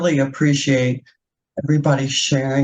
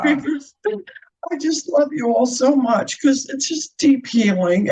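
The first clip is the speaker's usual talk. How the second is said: crying; 9 semitones higher than usual. Real, not acted. Thanking the healing circle, real tearful crying. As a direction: voice high and tearful with emotion. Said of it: "I want to apologize for crying".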